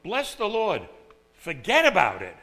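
quick, loud, casual punchline; emphatic everyday slang; modern-day paraphrase. Retold or imitated. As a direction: toss off "forget about it" like street talk. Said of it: "It's like".

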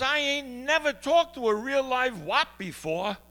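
high, loud, slow country drawl of amazement; the folksy Minnesota man, plainly thrilled; country guy. Retold or imitated. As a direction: play the innocent backwoods caller.

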